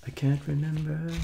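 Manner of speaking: a squeaky playful voice for the line; high silly voice. Pretend. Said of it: "repeats the line high".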